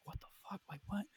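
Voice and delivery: hushed startled whisper; whispered confusion. Retold or imitated. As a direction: whisper it, scared of waking anything. Woken, broken window at night.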